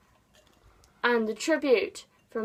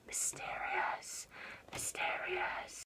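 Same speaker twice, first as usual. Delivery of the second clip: slow breathy whisper, drawn out; spooky whisper; 15 dB quieter, much slower than usual. Pretend. To viewers about the book cover, a slow spooky whisper. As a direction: whisper it to sound mysterious. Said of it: "It looks so spooky".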